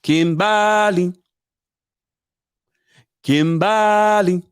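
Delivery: Kimbali stretched slow and loud, imitating him; mock Kimbali, slow and emphatic; his way of saying it. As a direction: drawl out Kimbali like he does. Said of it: "That's how he says it".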